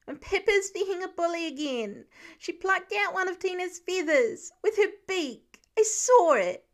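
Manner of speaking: put-on a small boy reporting a bully hen; upset little boy. A narrator's reading. Voiced youngest son Nate.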